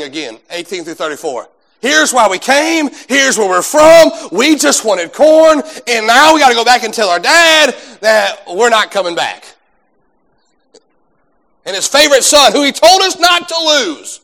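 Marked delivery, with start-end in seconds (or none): 1.8-9.5 loud, high, drawn-out plea; pleading protest. Acted. Acting out brothers' desperate plea. 11.6-14.2 high, loud, stretched-out lament; exasperated plea. Acted. Acting out brothers dreading father's reaction.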